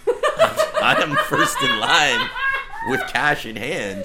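giggling through the words, slow and high; laughing. Real, not acted. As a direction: laugh it out, stretched and squealy. Gushing over joke movie idea.

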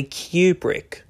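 Kubrick said slowly as "KYOO-brick"; careful Kubrick opening like "cue"; corrected pronunciation. Shown as a demonstration.

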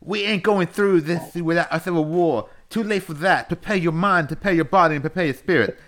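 high, loud Southern wrestling promo; hammed-up Southern wrestler rallying the troops; Dusty Rhodes impression. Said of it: "let me redo it as Dusty Rhodes".

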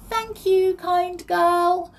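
high, loud, grateful little voice; pixie calling out. A narrator's reading. Freed pixie calls to Molly, grateful. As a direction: call thanks in a tiny high voice. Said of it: "she called to Molly".

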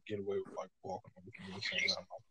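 whispered trailing mumble, too soft to catch; barely audible mumble. Real, not acted. Mumbling to his friend so softly he must repeat it. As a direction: drop to a breathy murmur under your breath. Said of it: "You feel like what?".

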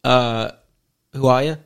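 half-hearted Australian guy's voice, barely put on; Australian accent. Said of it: "pretend to do an Australian accent".

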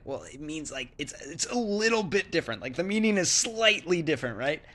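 lightly mock explaining to her. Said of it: "And I was like".

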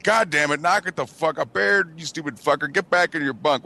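mock annoyed bunkmates. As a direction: scold him like woken-up bunkmates. Voices annoyed bunkmates yelling.

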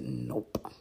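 an unvoiced, breath-only "Nope"; just breathe the word out; whispered nope. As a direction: friend flatly denying, whispered. Friend answers about the brothers.

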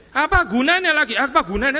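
mock-exasperated Jesus at his hearers; exasperated outburst. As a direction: explode with frustration. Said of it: "Mood swing dong".

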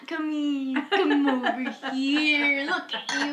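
lightly played cheerful drunk; happy drunk. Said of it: "here's that drunk".

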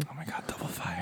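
hushed excited whisper. Real, not acted. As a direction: breathe it out in awe.

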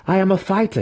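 high, defiant declaration; proud declaration. A narrator's reading. Student answering his attacking mentor, declaring proudly. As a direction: declare it with pitch right up. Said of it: "he declared".

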